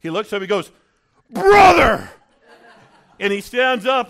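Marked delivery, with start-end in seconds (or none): 1.3-2.1 joyful shout. Retold or imitated. Joyful reunion with colleague.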